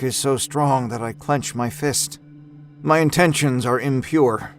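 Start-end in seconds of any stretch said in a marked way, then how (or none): none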